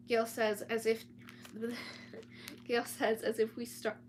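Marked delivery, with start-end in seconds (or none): 1.3-2.6 stifled laugh. Real, not acted. Laughing at own reading slip.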